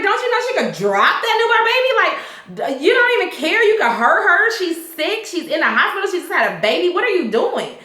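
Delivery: high, indignant telling-off; exasperated scolding. Retold or imitated. The viewer scolding Eddie through the screen, high and indignant. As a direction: scold him like he's lost his mind. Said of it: "I'm like".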